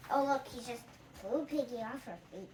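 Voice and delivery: plain reading voice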